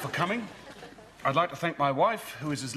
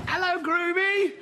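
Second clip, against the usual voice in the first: much higher, slow, sing-song teasing call; silly high greeting; 5 dB louder than usual. Acted. Best man greets the groom with a mocking high-pitched squeal. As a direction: squeal the nickname to wind him up.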